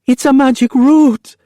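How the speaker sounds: put-on high, tearful child's plea; girl sobbing, defending herself. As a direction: sob it out. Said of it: "Ophelia sobbed".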